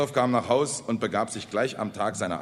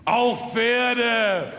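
loud, high, drawn-out proclamation; proud conviction; 13 semitones higher, 7 dB louder than usual. A narrator's reading. Speaker confirming the horses' fate, proud conviction. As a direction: declare it with full-chested pride. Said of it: "stolz im Brustton der Überzeugung".

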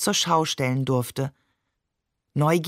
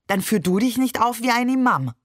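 higher and louder, a biting parting jab; cutting retort; 6 semitones higher, 3 dB louder than usual. Acted. Mother ending the quarrel with Barbe, scornful and raised. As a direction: throw the last word at him with scorn.

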